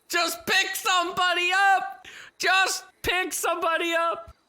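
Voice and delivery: exasperated yell at teammates; frustrated shout. Real, not acted.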